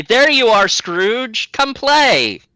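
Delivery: put-on high, loud kids' voices; children calling out. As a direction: call out like happy kids. Kids calling Scrooge to play.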